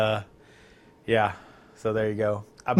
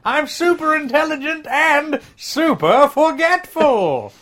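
whiny ape voice; 8 semitones higher than usual. Pretend. Ape character lament.

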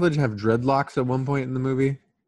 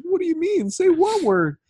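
much higher, bewildered mock voice; mimicking confused wife; 4 dB louder than usual. Wife baffled by husband, high and confused. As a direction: squeak it out in confusion. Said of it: "And she's like".

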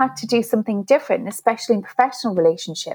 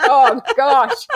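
higher, louder mock dismay through laughter; laughing dismay; 5 semitones higher, 5 dB louder than usual. Coach caught out by her own advice, laughing. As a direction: groan at yourself, amused.

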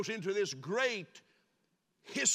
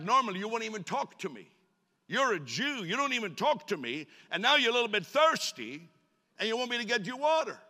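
higher, louder, fast sarcastic jabs; sarcastic woman; 5 semitones higher, 5 dB louder than usual. Retold or imitated. Preacher voicing the feisty woman snapping at Jesus, sarcastic. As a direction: needle him with bitter sarcasm. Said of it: "Maybe she was a little bit bitter".

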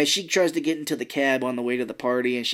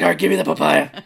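laughing demand; 4 semitones higher, 8 dB louder than usual. Retold or imitated. Quoting J-Lo while laughing.

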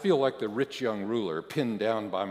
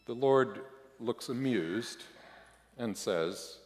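ordinary delivery, nothing marked

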